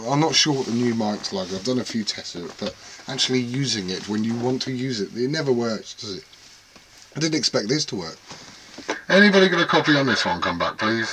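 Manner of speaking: usual speaking voice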